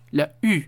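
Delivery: "U" francesa /y/, con la lengua adelantada; "U" formada adelante, junto a los dientes. Shown as a demonstration. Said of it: "Es como si hicieras la U, pero en la parte de adelante". (demostración de la u francesa)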